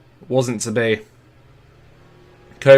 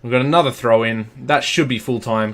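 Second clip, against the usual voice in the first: normal talking voice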